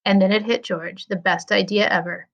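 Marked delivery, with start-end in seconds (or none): none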